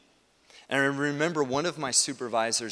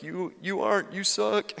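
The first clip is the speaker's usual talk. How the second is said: higher-pitched mock put-down; mock jibe; 6 semitones higher than usual. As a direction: blurt it as a playful jab. Voicing a critic's harsh inner jibe.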